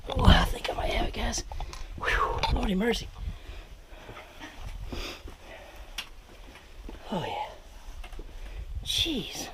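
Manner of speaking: whispering while squeezing through; hushed, effortful whisper; breathy whisper. Real, not acted. Squeezing through tight rock gap.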